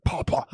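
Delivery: two short mouth-made gun pops; imitated shotgun blasts. Friend relives the car getting shot, imitated shotgun blasts. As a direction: mimic a shotgun going off twice.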